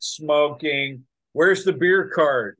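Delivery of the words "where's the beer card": lightly mocking loud American tourist; brash, slow, louder complaint; obnoxious tourist. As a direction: demand it like a pushy tourist.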